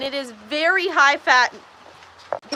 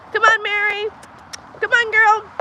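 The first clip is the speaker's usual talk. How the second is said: higher-pitched animal call; coaxing a cow; 5 semitones higher than usual. Real, not acted.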